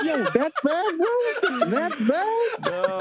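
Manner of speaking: high-pitched laughing incredulity; amused disbelief. Retold or imitated. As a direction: retell it squeaking with laughter at the news. Retells disbelief at friend's outburst.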